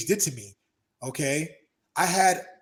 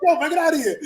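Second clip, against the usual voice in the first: much higher, louder put-on friend voice; mock confrontation; 12 semitones higher, 8 dB louder, faster than usual. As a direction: shove him off verbally. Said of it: "they're like".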